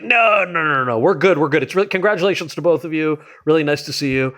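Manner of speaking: high, hurried mock-panicked refusal; flustered backpedaling. Flustered backing out, retold.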